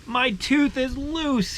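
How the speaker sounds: put-on a small boy's high, slow announcement; child's excited voice. Ethan telling his dad about the loose tooth, high childlike voice.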